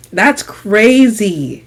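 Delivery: drawn-out, stunned; slow disbelief. Retold or imitated. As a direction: let it sink in slowly.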